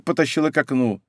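usual speaking voice

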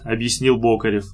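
plain reading voice

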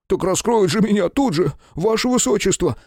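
high, wailing plea. A narrator's reading. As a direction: lament it like a panicked servant. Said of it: "тут же запричитал фотограф".

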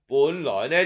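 ordinary delivery, nothing marked

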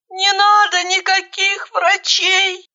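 high, slow, quavering plea; trembling plea. A narrator's reading. Injured girl refusing any doctors, trembling. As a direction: beg in a thin trembling voice. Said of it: "дрожащим голосом попросила она".

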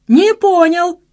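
very high, loud mock outrage; indignant shout. A narrator's reading. Friend challenging the excuse from below, shouting. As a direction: shout it up in disbelief. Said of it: "выкрикнул Пчёла".

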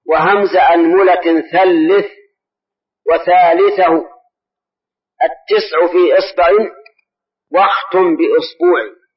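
slow, drawn-out chant of a teaching verse; chanting a grammar verse for students; verse recitation. Shown as a demonstration. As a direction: recite the rhyme slowly, line by line. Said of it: "يقول".

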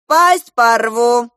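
enraged bellow at a captive; yelling general. A narrator's reading. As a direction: roar it word by word. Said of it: "заорал на него генерал армии".

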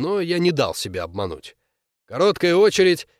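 plain reading voice

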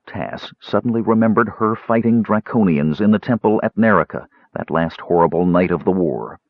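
ordinary delivery, nothing marked

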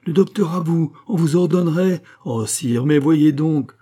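loud, high-pitched outrage; indignant exclamation. A narrator's reading. Queen protests to the king about being ordered, indignant exclamation. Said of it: "s'écria la reine".

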